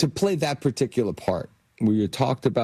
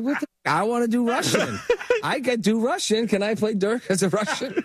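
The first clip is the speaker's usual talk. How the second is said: high-pitched, laughing comic outburst; mock protest; 12 semitones higher than usual. Actor retells his reaction to the accent, in high mock protest. Joking retort in a retold quarrel.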